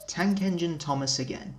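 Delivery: usual speaking voice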